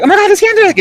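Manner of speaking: mock starstruck shriek; flipped-out fan. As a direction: imitate a high, loud squeal of excitement. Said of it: "Melanie totally flipped out".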